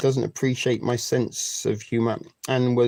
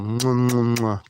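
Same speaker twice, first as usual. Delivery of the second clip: blown kisses; 2 semitones lower, slower than usual. Real, not acted. Blowing kisses to a viewer.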